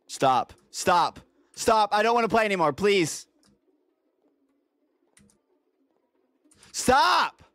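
rising panicked stop stop; scared pleading. Real, not acted. Being attacked by the monster.

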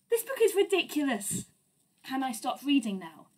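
ordinary delivery, nothing marked

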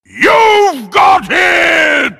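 very loud, high, drawn-out jump-scare yell; sudden shout. Acted. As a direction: shout each word long to startle the listener. Sudden shouted climax of ghost story.

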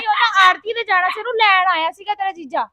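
loud, insistent explanation; loud explaining. Acted. Younger sister loudly explaining why the brother-in-law came. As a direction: shout the facts at her sister.

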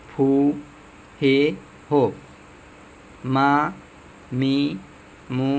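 each kana sound from আ to এন said singly, with a pause after it; pronunciation demo. Shown as a demonstration. Teacher showing learners how each hiragana is pronounced, syllable by syllable. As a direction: sound out আ, ই, উ through এন one by one. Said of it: "কোনটা কীভাবে উচ্চারণ করে শোনাচ্ছি".

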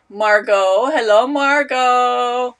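loud, slow sung greeting; sing-song hello. Real, not acted. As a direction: stretch the name out cheerfully.

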